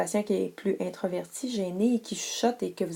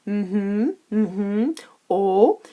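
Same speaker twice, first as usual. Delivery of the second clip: mhm, mhm, oh, the last loud; listening noises; 7 dB louder, much slower than usual. Shown as a demonstration. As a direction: nod along with mhm and a loud oh. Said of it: "lorsque vous faites des ha".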